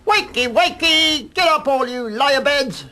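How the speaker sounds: high, loud, slow crowing cockerel call; crowing rooster. A narrator's reading. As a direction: crow it out like a proud rooster at dawn. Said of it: "you would hear him crowing".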